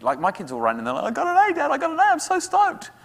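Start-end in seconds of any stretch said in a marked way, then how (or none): 1.1-2.9 much higher, louder, imitating a thrilled child; mock childish glee, much higher; thrilled kid. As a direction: squeal it like a kid bursting with good news.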